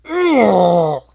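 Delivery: high, very loud "ugh" sliding down; loud cringing groan. Retold or imitated. Reacting to "my mom thinks I'm pretty", loud cringing groan. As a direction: cry out in pained cringe.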